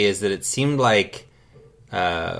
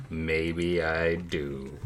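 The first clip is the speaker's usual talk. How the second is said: low, slow, quiet and teasing; slow ominous murmur; 4 semitones lower, 9 dB quieter, much slower than usual. Acted. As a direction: drag it out softly, like a threat.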